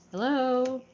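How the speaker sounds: usual speaking voice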